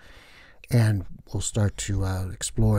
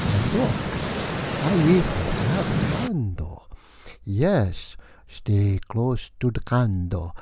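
hammy Transylvanian count, drawn out; vampire count voice; slower than usual. Acted. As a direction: play the theatrical vampire host welcoming guests. Host plays Count Vlad.

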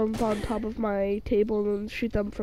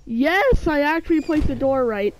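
loud, higher burst of delight; excited cheer; 4 semitones higher, 4 dB louder than usual. Real, not acted. Gamer cheers after placing the door right, excited. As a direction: cheer like you finally got it right.

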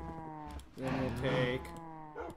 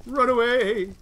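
raised, higher call after fleeing foes; 6 semitones higher, 6 dB louder, slower than usual. Real, not acted. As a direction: shout it after the ones running off. Calling after fleeing enemies in game.

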